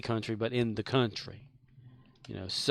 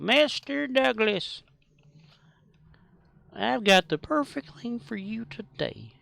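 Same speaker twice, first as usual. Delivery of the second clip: very high, slow put-on salesman voice; hammed-up TV huckster drawing out each word; Mister Haney impression; 12 semitones higher, 3 dB louder, slower than usual. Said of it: "Mister Haney on there, the way he talked".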